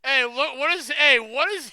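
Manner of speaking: 大声で驚いて叫ぶ; loud, startled calling out; excited shout. Real, not acted. Shouting at chaotic stream.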